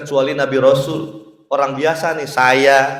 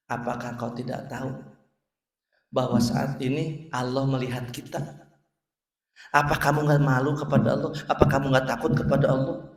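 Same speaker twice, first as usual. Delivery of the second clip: quiet rebuke; 5 semitones lower, 9 dB quieter than usual. Acted. Widow rebukes the rich man.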